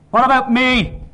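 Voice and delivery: put-on high, loud baby's wail in words; angry wee man's high yell; strangled angry outcry. A narrator's reading. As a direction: shriek it like an outraged infant. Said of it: "The strangled outcries of an angry wee man".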